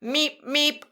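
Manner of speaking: high, loud meep meep; bus horn meep. Shown as a demonstration. Teacher beeping like a bus, loud. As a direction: beep it like a little horn. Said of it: "your turn to make the sound".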